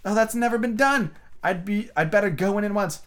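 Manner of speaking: high, alarmed plea; dismayed at the task; Alice in despair over the feast. A narrator's reading. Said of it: "Alice pleaded with despair".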